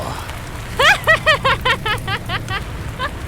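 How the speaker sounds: high rising villain giggle; high giggling laugh. Acted. Joker taunting Dick over the phone, high rising giggle. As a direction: giggle upward, teasing.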